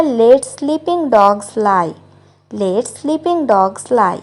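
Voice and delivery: very slow, careful English model line; slow model sentence. Shown as a demonstration. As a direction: say each word slowly for learners. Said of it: "English में कहते हैं". (धीरे-धीरे साफ़ बोला गया वाक्य)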